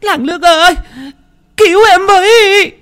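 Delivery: high, loud scream for help; terrified cry for help. Acted. Frightened woman screaming to the village for help. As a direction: shriek for the village to save her. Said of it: "tiếng của thím Hiên kêu lên".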